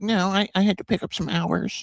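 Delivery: teasing high-pitched imitation; mocking friend's excuse. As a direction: parrot his excuse back in a high voice. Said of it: "but you were like".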